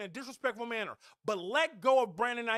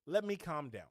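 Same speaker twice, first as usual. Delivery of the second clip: soft, low, calming exhale; a real, quiet woosah to cool off; calming himself down; 9 semitones lower, 8 dB quieter than usual. Real, not acted. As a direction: breathe out the anger. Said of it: "I'm getting irritated".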